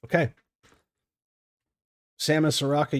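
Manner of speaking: ordinary talk, nothing marked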